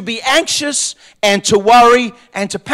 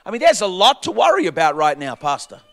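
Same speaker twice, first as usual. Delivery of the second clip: a hint of a fretful church member, quieter and lower; doubting congregant; 4 semitones lower, 7 dB quieter, faster than usual. Pastor voicing a doubting member of his congregation, hushed and low. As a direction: mumble the objection like a worried listener. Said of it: "You say".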